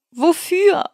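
high, loud outraged cry from the water; shocked shout. A narrator's reading. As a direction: yell it as you surface, stunned. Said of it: "rief er als erstes".

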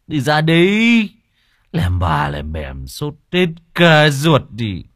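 put-on drunken slur. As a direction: drag the words out like a groggy drunk. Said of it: "buông giọng lè nhè".

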